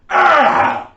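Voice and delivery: loud high-pitched scream. Shown as a demonstration. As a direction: let out a piercing shriek. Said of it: "Right off the bat, I scream".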